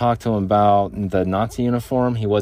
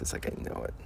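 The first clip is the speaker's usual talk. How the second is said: whisper; 13 dB quieter, faster than usual. Retold or imitated. Inner thought, whispered.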